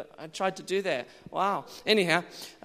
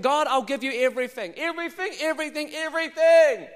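high-pitched crescendo on each repeat; fervent surrender; 8 semitones higher, 6 dB louder than usual. Acted. Preacher models offering God his whole self, fervently building. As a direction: build to a loud, high cry of devotion.

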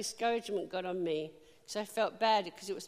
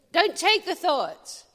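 louder, slow, pounding the point; forceful slow exhortation; loud preaching; 7 semitones higher, 10 dB louder, slower than usual. Acted. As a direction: preach it hard, each word landing. Preacher driving home point.

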